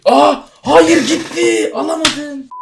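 high, loud wail of loss, slowing down; panicked cry. Real, not acted. Streamer panicking that the item vanished, high loud wail. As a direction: cry out as the prize slips away.

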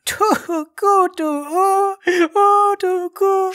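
high-pitched wailing sobs in gibberish; crying alien woman. Acted. Alien woman asked about the plan, crying shrilly. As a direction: sob squeakily as a tiny alien. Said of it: "She's crying".

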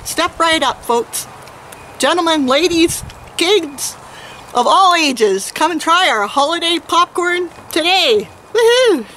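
high, bright carnival barker call; chirpy trade show pitch. Acted. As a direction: sell it like a fairground barker, all smiles. Closing sales pitch, barker call.

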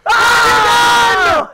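high, very loud drawn-out "oh"; loud yell. Real, not acted. Player reacting to the coffee going flying, loud high yell. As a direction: yell out as it all goes wrong. Cries out as the game goes wrong.

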